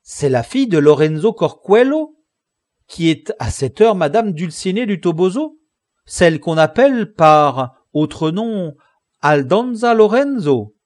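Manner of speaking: loud, high-pitched incredulous questioning; astonished exclamation. A narrator's reading. Sancho checks with Don Quichotte who Dulcinée really is, loud astonishment. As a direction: blurt it out loudly, amazed, voice lifted. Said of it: "s'écria Sancho".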